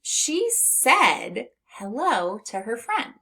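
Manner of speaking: slow, clear model sentence; slow example sentence. Shown as a demonstration. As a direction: read it slowly for learners. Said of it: "For example".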